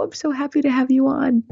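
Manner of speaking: high, quick, gushing greeting; delighted welcome. Real, not acted. Host welcomes her friend onto the show, delighted. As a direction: welcome them with a burst of high-pitched delight.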